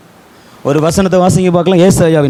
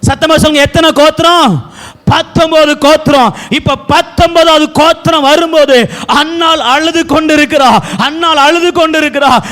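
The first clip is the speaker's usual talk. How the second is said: raised pulpit voice; full-force preaching; 10 semitones higher than usual. Acted. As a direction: preach it high to the whole congregation.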